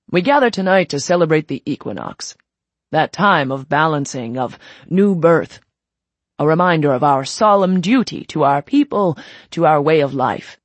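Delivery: loud, solemn address to a crowd; formal speech. Acted. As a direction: speak like a leader at a ceremony. Leader's solemn speech to the Order.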